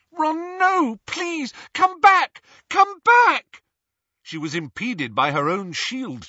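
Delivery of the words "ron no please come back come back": high, loud, sobbing plea; crying out. Acted. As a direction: beg him to come back, crying. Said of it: "sobbing and calling Ron's name".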